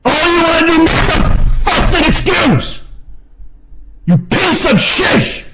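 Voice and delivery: high, drawn-out angry shouting; upset screaming rant. Real, not acted. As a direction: scream it out slowly in fury. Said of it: "I should not have to get upset at people".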